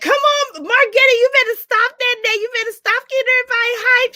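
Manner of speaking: high, loud excited hollering; hyped call-out. Real, not acted. Host hollering at a hyped-up viewer, high and loud. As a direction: cheer her on loud.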